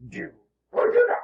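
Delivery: croaky little Jedi master impression; Yoda. Speaker ends with his Jedi motto, in a Yoda voice. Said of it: "a simple phrase from Master Jedi Yoda".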